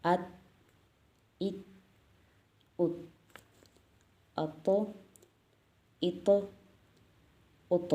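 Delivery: "अत, इत, उत" read fluently with a bouncing echo. Shown as a demonstration. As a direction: read "अत इत उत" smoothly, each with qalqala. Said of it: "रवानी में पढ़ाती हूँ". ("अत, इत, उत" रवानी में, गूँज के साथ)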